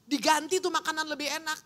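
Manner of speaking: high sharp outburst at the waiter; mock angry customer. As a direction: snap at the waiter, hammed-up. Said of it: "kalau misalnya Anda emosi". (pelanggan marah pura-pura, tinggi)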